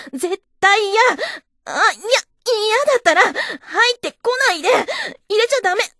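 high, loud, desperate pleading cries; loud frantic refusal, a cry at the end; pained protest. Acted. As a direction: beg him to stop, in real pain. She protests in pain.

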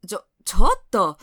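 sudden loud fast stammer; startled outburst. Acted. Senior startled when her junior bursts into tears. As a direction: blurt it out, caught off guard.

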